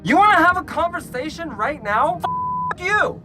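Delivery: angry challenge. Retold or imitated. Storyteller to the yelling driver, angry high-pitched comeback. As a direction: challenge him, then explode.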